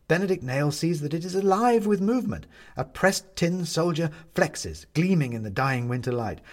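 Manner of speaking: plain reading voice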